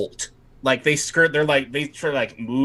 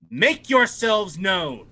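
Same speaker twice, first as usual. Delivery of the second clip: higher, slow, imperious demand; slow, higher command; slow lordly command; 7 semitones higher, slower than usual. Acted. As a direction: draw out the order like a threat. Night Lord's summons continues.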